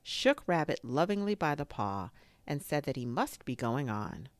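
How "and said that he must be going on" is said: ordinary delivery, nothing marked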